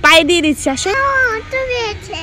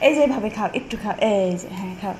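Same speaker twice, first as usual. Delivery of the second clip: softer, lower coaxing to eat; feed her gently and quietly; gentle coaxing; 9 semitones lower, 11 dB quieter than usual. Real, not acted. Mother coaxing daughter to taste the pitha, soft coaxing.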